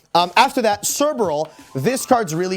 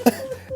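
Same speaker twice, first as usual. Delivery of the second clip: giggling. Real, not acted. Laughs again.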